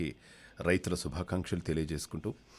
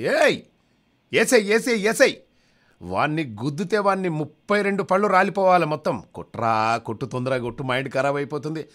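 very high, loud ringside shouting; yelling fan; 12 semitones higher, 11 dB louder than usual. Acted. Dentist cheering at a boxing match, yelling very high and loud. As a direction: scream at the boxer to hit harder.

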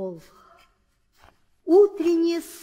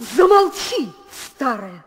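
angry rebuke; 6 dB louder than usual. Acted. Queen angrily silences her old nurse. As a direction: cut her off in anger.

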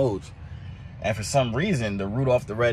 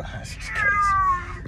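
high exasperated drawl; much slower than usual. Real, not acted. Exasperated by the crying cat.

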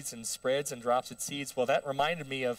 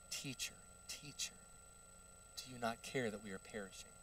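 very soft, hushed, polite plea; timid understatement; 3 semitones lower, 16 dB quieter than usual. Acted. Disciples waking Jesus in the storm, hushed and meek. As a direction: murmur it far too meekly for an emergency. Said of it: "I don't think that's how they said it".

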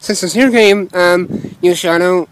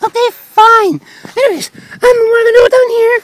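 high character voice. Acted.

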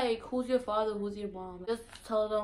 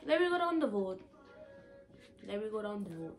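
put-on Virgin Islands accent; 2 semitones higher than usual. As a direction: call to a friend heading down the road, island style. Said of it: "We be like".

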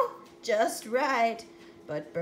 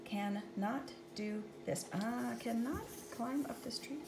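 quiet lament; 11 dB quieter than usual. Acted. Quiet disappointed character.

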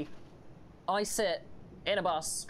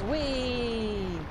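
high, loud joyful whee; gleeful whee; 8 semitones higher, 5 dB louder than usual. Real, not acted. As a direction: squeal with delight. Jumps down happily.

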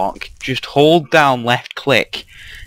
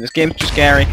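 usual speaking voice

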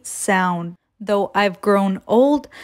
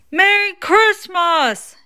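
high, slow storybook call; shouted; 10 semitones higher, slower than usual. A narrator's reading. Conductor shouts from moving train, high drawn-out mild call. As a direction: draw it out high, a mild shout across distance. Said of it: "he shouted".

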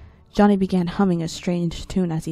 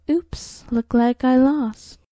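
mock innocent; 6 semitones higher, 6 dB louder, slower than usual. Child mock loss.

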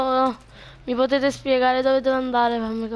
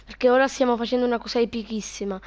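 ordinary talk

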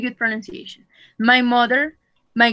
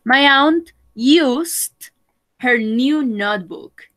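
slow model; slower than usual. Shown as a demonstration. Teacher slowly modelling the words for Rose. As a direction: draw each word out for learners.

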